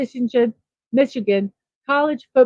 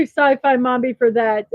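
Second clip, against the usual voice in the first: ordinary talk, nothing marked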